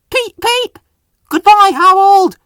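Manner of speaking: loud, high gleeful whistle and shout. A narrator's reading. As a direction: crow goodbye while racing past. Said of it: "he shouted".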